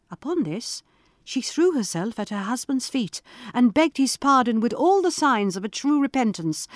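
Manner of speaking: usual speaking voice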